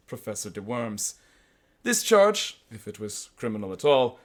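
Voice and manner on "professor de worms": matter-of-fact policeman; civil, unexcited official. Retold or imitated. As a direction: state it evenly and politely. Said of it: "he said civilly".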